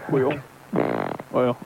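lightly put-on folksy trucker voice; Microphone Johnson character. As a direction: play it as a gruff hardliner caller. Holds a put-on character throughout.

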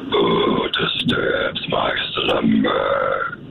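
slow rasping whisper; grinding stone statue. Acted. Caller plays Cave of Wonders.

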